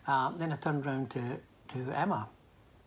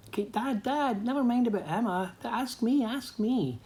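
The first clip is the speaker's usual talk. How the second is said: put-on high, loud pleading yell of a little girl; eager child shouting; 10 semitones higher, 4 dB louder than usual. Youngest daughter shouting to Dad to be asked, high eager yell. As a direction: call out to Dad, desperate to be picked. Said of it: "Katie starts shouting".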